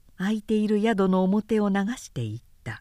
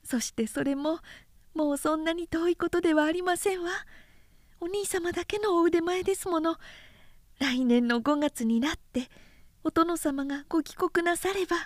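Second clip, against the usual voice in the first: put-on higher, gentle young woman pleading; tender younger sister, higher voice, hushing the end; young sister's voice; 9 semitones higher, 3 dB quieter than usual. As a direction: coax him hopefully, higher and softer. Sister encourages brother.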